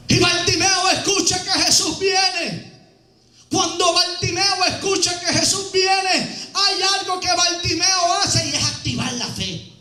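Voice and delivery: full-voiced preacher's storytelling; preaching. Preacher tells the congregation about Bartimeo, preaching.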